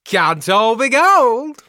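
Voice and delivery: very high, loud prospector voice; bad prospector. The worst prospector checking each find for gold, high hammed-up cry. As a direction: cry out over the diggings, hammed up. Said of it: "like, the worst prospector".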